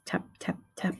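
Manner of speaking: soft whispered tap, tap, tap; whispered tapping. A narrator's reading. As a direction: breathe the taps like a tiny sound. Egg tapping sound read softly.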